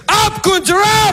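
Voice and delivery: high, shouted order; circus master's command. Acted. Brother taunts mum stuck in her chair, shouted mock command. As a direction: holler it like a circus trainer raising an elephant. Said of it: "and goes".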